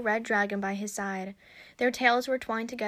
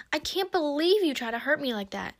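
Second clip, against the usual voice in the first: mildly indignant, pitched higher; angry accusation; 5 semitones higher than usual. A narrator's reading. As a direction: accuse with a touch of hurt anger. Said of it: "anger covered her face".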